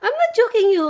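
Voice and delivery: "not" pushed high and loud in "I'm not joking you"; loud, high mock-insistent reply to son; insistent denial. Real, not acted. Insisting to son he's handsome.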